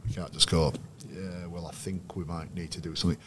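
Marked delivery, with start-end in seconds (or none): 1.1-3.2 very soft, half-hearted mumble; undercooked delivery. Shown as a demonstration. Coach showing players how not to deliver, limp and quiet. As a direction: demo a weak, underpowered coaching line. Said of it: "You gotta have a, a bit of a delivery".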